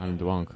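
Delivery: put-on drunk said as twunk; I'm drunk with a w for the r; drunk with a speech impediment. Shown as a demonstration. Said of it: "Say you're drunk".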